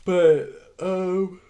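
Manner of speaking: talking through a yawn; mid-yawn, stretched words. Real, not acted. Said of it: "I'm sleepy".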